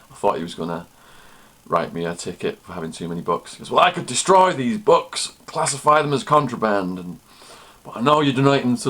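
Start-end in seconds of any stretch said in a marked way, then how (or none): 3.7-8.9 raised, higher guard voice; imitated guard weighing a threat. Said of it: "big redneck, chewing tobacco".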